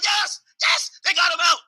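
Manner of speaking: high shouted mock celebration; cheering crowd. Venezuelans cheering the kidnapping, high excited shout. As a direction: shout it like the streets are partying. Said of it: "They're like".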